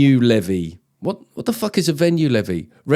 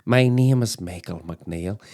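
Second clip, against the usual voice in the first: put-on slow, lower Northern Irish accent; 4 semitones lower, slower than usual. Presenter playing Michael introducing himself in a Northern Irish accent. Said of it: "the most Northern name ever".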